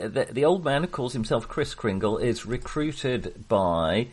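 ordinary talk, nothing marked

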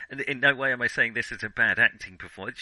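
usual speaking voice

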